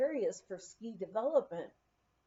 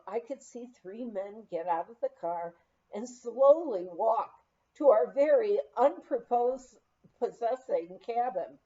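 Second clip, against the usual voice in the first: usual speaking voice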